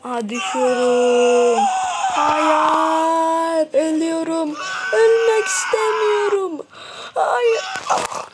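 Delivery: rising panicked screams; screaming while falling. Acted. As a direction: yell in terror while dropping.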